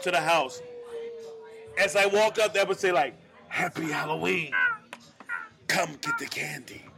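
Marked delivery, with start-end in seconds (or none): 3.5-6.8 lightly voiced scary host luring visitors in; spooky luring. Luring trick-or-treaters to the candy, mildly spooky. Repeats his hidden-speaker scare voice.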